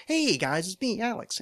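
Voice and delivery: exaggerated podcast host catchphrase voice; weird show-intro voice. Shown as a demonstration. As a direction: open the show in a silly high voice. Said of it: "in what I call the Alex Cast voice".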